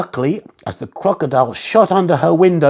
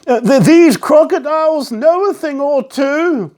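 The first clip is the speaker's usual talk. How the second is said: put-on old man voice; 9 semitones higher than usual. A narrator's reading. Mister Whisker speaking.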